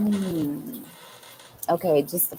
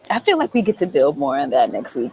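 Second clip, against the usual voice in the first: laughing; 6 semitones higher, 9 dB louder than usual. Real, not acted. Laughing while excited.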